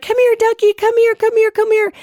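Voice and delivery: put-on very high, cooing call to a baby bird; coaxing a duckling. Calling the lost gosling to shore, high cooing coax. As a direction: sweet-talk the gosling over in a tiny high voice. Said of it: "And I'm like".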